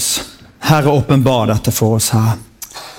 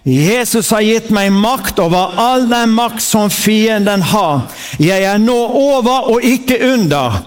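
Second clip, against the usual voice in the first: raised, proclaiming preacher's voice, line by line; preaching at full force; 7 semitones higher, slower than usual. Acted. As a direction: declare each line like a battle cry for the church to echo. Leads congregation in declaring scripture.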